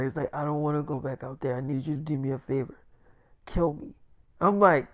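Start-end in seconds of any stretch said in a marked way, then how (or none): 0.3-3.9 soft, low-energy plea; feeble, drained murmur; imitated weakness. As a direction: plead quietly like a badly hurt man. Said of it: "'cause he was still kinda weak".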